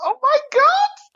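very high, drawn-out retold disbelief; mock astonishment. Actor recalling her reaction to the tourists, in high astonishment. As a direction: squeal it slowly, amused and amazed. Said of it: "I thought".

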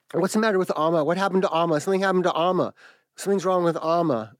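high, panicked pleading for news; a scared kid begging to know what happened; frantic child. Retold or imitated. Young boy frantically asking his mother about grandmother, high and panicked. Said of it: "And I am frantic".

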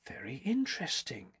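whispered under the breath; hushed whisper. A narrator's reading. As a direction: murmur it to herself while studying. Critic studies the sculptures.

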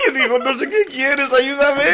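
high-pitched, laughing plea; words bubbling up in high laughter; laughing helplessly. Real, not acted. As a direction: squeal it out through laughter. Cracking up while telling his confusion.

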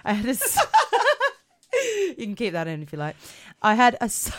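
giggling through the words; cracking up mid-sentence; laughing. Real, not acted. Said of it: "laughing at own slip".

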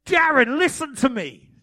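loud, high radio hailing; mock dispatcher trying hard to get through; urgent walkie-talkie voice. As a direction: shout insistently into a two-way radio. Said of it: "Then it happened more and more".

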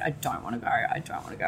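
very soft, low mock pleading; quiet reluctance. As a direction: mumble it small, not wanting to leave. Retold reluctance to leave LA.

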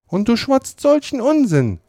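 höher, kindlich petzend; Sid. A narrator's reading. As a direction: sprich wie der kleine Bruder. Sid als Figur. (Sid petzt beim Frühstück, höher)

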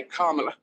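"Kamala" with stress on the first syllable, KAH-ma-la; correct name pronunciation. Shown as a demonstration. Teaching the interviewer her name: "Kamala" stressed on the first syllable. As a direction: say "Kamala" leaning on the opening KAH, the rest light. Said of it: "Put a dash after the A".

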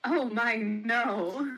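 a scoffing matron refusing ten dollars; scornful. Acted. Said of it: "she said scornfully".

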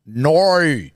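NO drawn out as Aussie "noor"; Australian accent. Shown as a demonstration. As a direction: bend NO into a long Australian diphthong. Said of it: "this is the fundamental difference".

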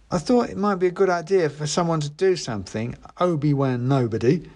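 put-on know-it-all teen; boy voice. A narrator's reading. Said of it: "he said".

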